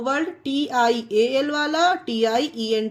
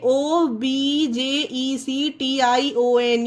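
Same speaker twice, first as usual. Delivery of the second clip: each letter set apart, slow and clear; slow spelling; much slower than usual. Shown as a demonstration. Teacher spelling a word for the class, slowly. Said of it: "देखिए".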